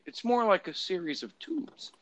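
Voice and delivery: high, soft mock senator voice; Ted Stevens. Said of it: "he literally calls it".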